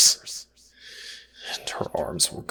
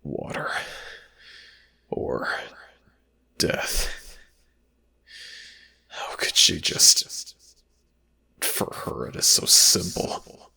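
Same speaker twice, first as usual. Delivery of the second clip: hushed, breathy narration; whisper. Acted. Narrator whispering over the suffering slave girl. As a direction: whisper it like a secret witness.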